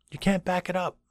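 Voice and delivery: high-pitched insistence; passionate professor. Retold or imitated. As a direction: press the point on the class. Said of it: "He said".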